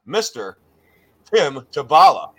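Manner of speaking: loud emcee introduction building to the name; announcer's name reveal. Acted. Climax of the guest introduction.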